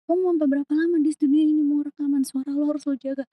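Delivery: high-pitched nagging manager imitation; imitated manager. Manager comes to the studio to warn the singer, high nagging imitation. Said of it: "manajer gue datang kayak".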